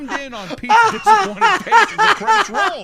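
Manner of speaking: frantic laughing retelling; hyped narration. Real, not acted. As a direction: belt it out in disbelief. Hyped narration of sad moment.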